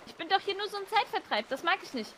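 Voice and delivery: repeated for clarity. Real, not acted.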